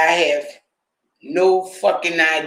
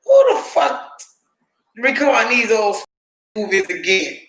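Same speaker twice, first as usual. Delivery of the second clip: incredulous outburst; 4 semitones higher than usual. Retold or imitated. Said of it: "I was like".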